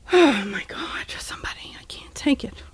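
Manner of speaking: whispered, exasperated plea. Real, not acted. Fed-up host begs for rescue, whispered. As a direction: breathe it out in despair.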